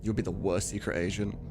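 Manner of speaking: ordinary talk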